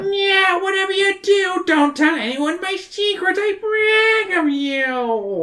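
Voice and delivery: very high, slow mad-scientist voice; a giddy, squeaky inventor crowing about profits; eccentric professor. Acted.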